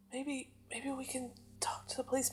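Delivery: hushed, fearful whisper. Acted. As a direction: whisper so he won't snap.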